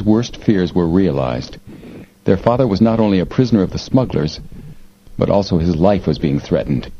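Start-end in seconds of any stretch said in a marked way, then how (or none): none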